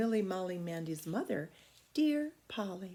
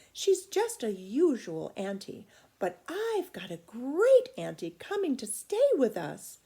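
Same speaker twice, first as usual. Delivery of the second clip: put-on louder, higher, proud little girl; a little girl boasting to her friend about a visitor; 4 semitones higher, 6 dB louder than usual. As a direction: show off the big news.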